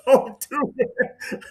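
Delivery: high, slow and laughing; laughing don't do this, slowed down; laughing protest. Retold or imitated. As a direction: beg through the laughter. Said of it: "And I said".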